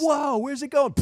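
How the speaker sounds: high-pitched retold alarm; startled alarm. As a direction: act out a panicked dodge. Parent startled by projectile puke.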